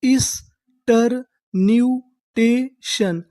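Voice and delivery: stretched out slowly, each syllable apart; teacher drawing out every syllable. Shown as a demonstration. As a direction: spell it out slowly for students. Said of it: "तो हमारी पूरी meaning क्या बनी?".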